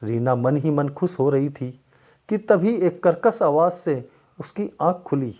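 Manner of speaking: ordinary delivery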